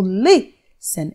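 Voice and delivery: LIS stretched out and stressed, then a light ten; stress on first syllable. Shown as a demonstration. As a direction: draw out the LIS of listen. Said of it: "Mais forte".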